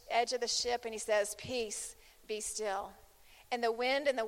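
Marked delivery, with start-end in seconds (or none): 1.3-2.9 soft, calm, unhurried command. Retold or imitated. Jesus calming the storm, softly and calmly. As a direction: still the storm with quiet authority. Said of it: "he's all calm, cool, and collective".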